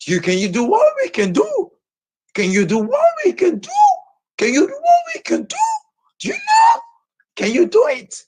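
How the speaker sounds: shrill escalating dare; rising challenge. Real, not acted. Rising challenge, voice climbing high.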